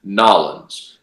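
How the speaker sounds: "Nolans" as two syllables, "NAW-linz"; the movie-style contraction "Nolans". Retold or imitated. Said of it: "no one in New Orleans actually says".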